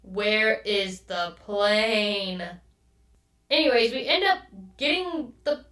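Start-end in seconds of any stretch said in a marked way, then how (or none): none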